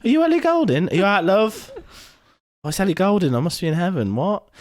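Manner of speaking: high-pitched acted wonder; pretend amazement. Crashed driver meeting a singer, pretend amazement. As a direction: voice a starstruck, dazed exchange. Imagined driver and celebrity exchange.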